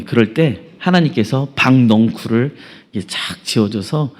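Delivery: ordinary delivery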